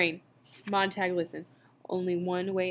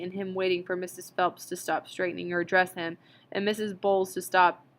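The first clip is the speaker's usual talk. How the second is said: ordinary delivery, nothing marked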